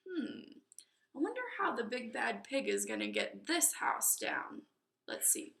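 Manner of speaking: put-on soft musing to the children; wondering aside. A narrator's reading. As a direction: drop to a curious hush. Narrator aside to listeners.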